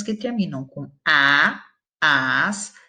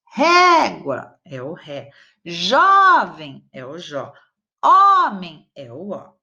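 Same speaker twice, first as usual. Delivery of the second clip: "Régua é o ré", "Jovem é o jó", "Homem é o ó" said slowly and a bit louder, each stressed syllable held out; naming the stressed syllable; 4 dB louder, slower than usual. Shown as a demonstration. Teacher drills the stressed syllables of régua, jovem and homem, slow and emphatic. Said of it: "sublinhar a sílaba tônica".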